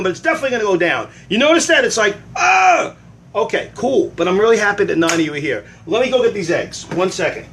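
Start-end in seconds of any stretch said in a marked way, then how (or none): 2.4-2.9 high loud frustrated ugh. Retold or imitated. Groaning in frustration over the viewer count. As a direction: let out an exasperated groan.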